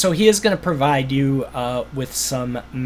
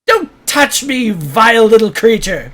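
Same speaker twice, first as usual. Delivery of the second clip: disgusted wizard; 8 semitones higher, 7 dB louder than usual. Acted. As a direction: recoil and sneer out the insult. GM wizard recoils from handshake.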